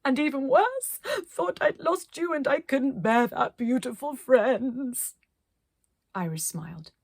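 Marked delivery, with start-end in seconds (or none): 0.0-5.2 high, slow, choked emotional speech; weepy relief. Acted. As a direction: cry with relief. Weepy relief after being lost.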